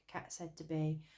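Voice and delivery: ordinary delivery, nothing marked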